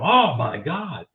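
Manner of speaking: slow, drawn-out mock shock; mildly acted freak-out. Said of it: "they were all freaking out".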